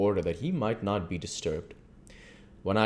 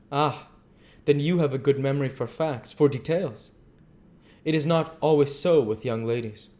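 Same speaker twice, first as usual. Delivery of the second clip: higher, louder voice for the earnest foreign doctor; earnest old doctor; 8 semitones higher, 5 dB louder than usual. Acted. The doctor to Mina, explaining his visit, grave and courteous.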